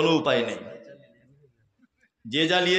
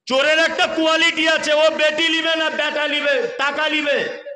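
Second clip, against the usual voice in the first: high, louder mocking condemnation; scornful preaching shout; 10 semitones higher, 4 dB louder than usual. Real, not acted. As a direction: lash out at greedy fathers. Preacher scolds dowry-takers loudly. (উঁচু জোরালো ধিক্কার)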